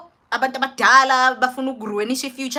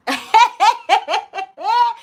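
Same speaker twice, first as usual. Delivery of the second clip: high, loud cackling laugh; 11 semitones higher, 6 dB louder than usual. Real, not acted. Storyteller reacts to the doctor's intimate question, high loud laugh. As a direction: burst into a pitched-up staccato laugh.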